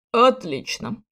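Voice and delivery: mild growled spite; angry growl. A narrator's reading. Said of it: "Злобно прорычал принц".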